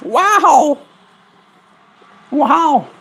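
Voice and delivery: delighted wow way up high; high cooing wow; high excited wow. Real, not acted. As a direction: gush at the bird. Reacting to the bird.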